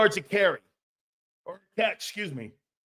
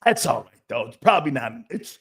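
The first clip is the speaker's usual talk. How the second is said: ordinary talk, nothing marked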